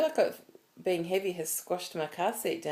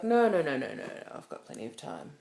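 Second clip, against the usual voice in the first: put-on dismissive old man; 2 semitones lower than usual. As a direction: brush it off like a stubborn old man. Old actor brushing her off.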